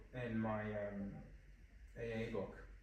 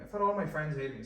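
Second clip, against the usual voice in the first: emphatic repeat; 4 semitones higher, 8 dB louder than usual. Shown as a demonstration.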